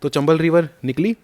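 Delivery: ordinary delivery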